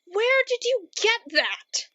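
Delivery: high, loud startled cry; shocked outburst. Acted. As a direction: blurt it out in disbelief. Narrator sees a kitten with chainsaw.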